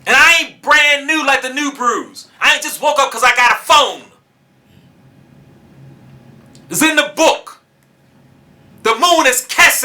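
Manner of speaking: high, loud, fervent sermon delivery; preaching at full force. Acted. Preacher warning the teachers of Yisrael, at full force. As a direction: hammer the point home from the pulpit.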